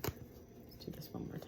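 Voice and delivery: soft unvoiced whisper to viewers. Real, not acted. Reader whispers to viewers while reshuffling the cards, unvoiced. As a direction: hush it down as if sharing a secret.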